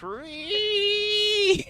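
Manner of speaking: very high, long-held squeal; mock "ree" screech. Reacting to a giant Trixie plush joke, long mock screech. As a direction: screech one long rising "reee". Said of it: "that's why I read at it".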